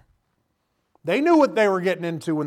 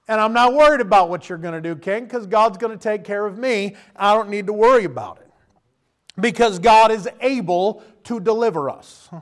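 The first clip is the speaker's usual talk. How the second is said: bold declaration; 4 semitones higher, 7 dB louder than usual. Acted. The men answer the king.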